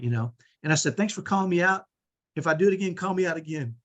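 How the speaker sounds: ordinary talk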